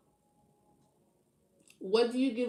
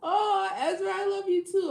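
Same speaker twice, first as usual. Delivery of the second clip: high, loud cooing; doting high-pitched sing-out; gushing affection; 10 semitones higher, 4 dB louder than usual. Real, not acted. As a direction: gush sweetly, pitched way up. Gushing reply to a loved one.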